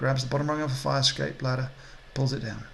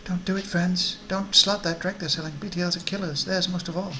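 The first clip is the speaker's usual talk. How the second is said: higher-voiced worried woman; concerned woman; 5 semitones higher than usual. Woman warning strangers off deadly chips, concerned and higher. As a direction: plead gently in a higher voice. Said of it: "She looks at you with concern".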